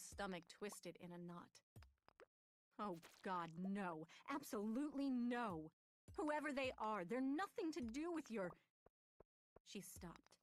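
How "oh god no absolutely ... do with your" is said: loud, high angry refusal; heated refusal. Acted. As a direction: refuse him hotly. Karoo refuses in heated argument.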